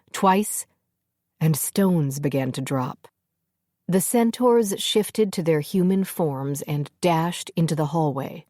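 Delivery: usual speaking voice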